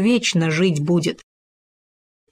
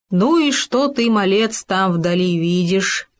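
usual speaking voice

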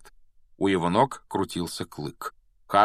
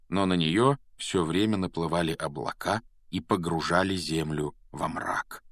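plain reading voice

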